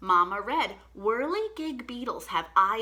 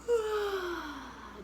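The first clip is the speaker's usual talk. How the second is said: long soft sighing yawn; demo yawn; 4 semitones higher, 5 dB quieter than usual. Shown as a demonstration. Teacher yawning again with the kids, soft sleepy yawn. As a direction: let a sleepy cat yawn out. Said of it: "Let's do it again".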